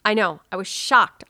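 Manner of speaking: normal talking voice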